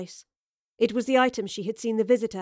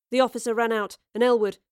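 ordinary delivery, nothing marked

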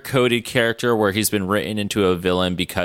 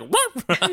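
a short, high mock bark; a light high woof; imitated dog bark. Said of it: "the dog was like".

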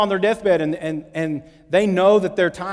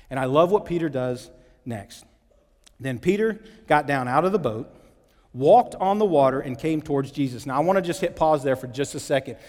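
ordinary talk

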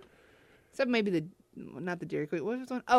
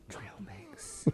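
whispering; 21 dB quieter than usual. Acted. Repeating punchline in whisper.

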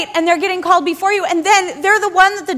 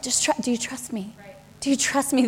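much softer, tender question; gentle Holy Spirit; 4 semitones lower, 10 dB quieter than usual. Acted. Holy Spirit gently asking the believer, soft and tender. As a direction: ask it softly, like a patient friend. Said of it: "Holy Spirit's just like".

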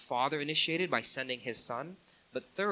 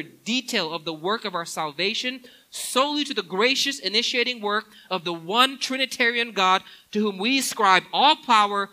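louder, higher, driving preacher's build; preaching at full force; 4 semitones higher, 10 dB louder than usual. Acted. Preacher drives home the gospel to the congregation, full force. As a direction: pile each clause on, rising to praise. Sermon climax, building intensity.

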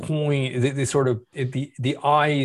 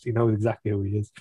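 ordinary delivery

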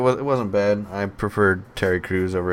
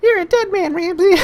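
much higher, louder put-on villain voice; movie villain threat; 6 dB louder than usual. Quoting the wrestler's movie line to his co-host, put-on villain voice. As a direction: bark the threat like a cheesy action villain. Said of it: "he's the one that said".

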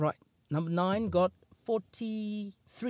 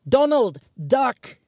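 sudden high, loud shout of a name and warning; yell; 9 semitones higher, 10 dB louder, slower than usual. Acted. Secret Service agent yelling a warning in a joke. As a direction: bellow it like a guard diving in. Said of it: "They now have to yell".

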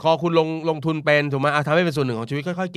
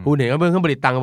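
เครื่องผลิตตังค์ said louder with a falling end; emphatic repeat; 3 semitones higher, 6 dB louder than usual. Real, not acted. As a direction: repeat the point louder to drive it home.

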